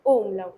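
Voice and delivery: ओ umlaut with a rounded o, then OOM-lout; name ओ umlaut clearly; teacher naming the umlaut letter; letter name demo. Shown as a demonstration. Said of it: "जैसे क्योर बोलते हैं आप".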